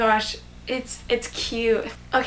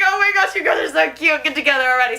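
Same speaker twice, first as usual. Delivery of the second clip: gushing impatient fan; 5 semitones higher, 3 dB louder, faster than usual. Acted. Fan urging the couple on.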